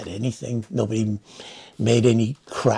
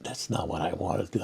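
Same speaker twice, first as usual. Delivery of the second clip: a whispered private curse, much softer; muttered to himself; 5 semitones lower, 11 dB quieter than usual. Retold or imitated. Recalling his silent reaction to the clerk offer, whispered under his breath. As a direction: breathe it under your breath, not for the officer. Then to me, I said.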